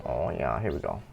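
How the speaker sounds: ordinary delivery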